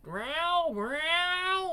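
high drawn-out meow; cat meow. Acted. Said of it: "they got a cat there".

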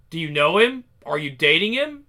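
put-on father pressing daughter about a boy; suspicious demand. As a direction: corner her with suspicion. Father interrogates.

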